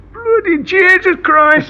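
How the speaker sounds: high, slow startled cry; startled oath. Retold or imitated. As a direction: blurt it out in alarm, pitched high. Startled soldier shouts at enemy.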